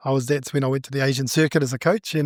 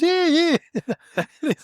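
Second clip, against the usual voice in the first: a breezy, much higher offhand "yeah, yeah"; casual yeah yeah; 12 semitones higher than usual. Retold or imitated. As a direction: toss it off high and easy like it's nothing. Friend's offhand agreement retold.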